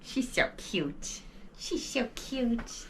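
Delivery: soft slow whispered coo. Real, not acted. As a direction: breathe it out adoringly, slowly. Cooing over the dog.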